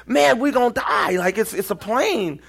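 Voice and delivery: high, hammed-up doom to the driver; mock dread. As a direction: tell the driver you will die in a high voice. Panicked retelling to driver.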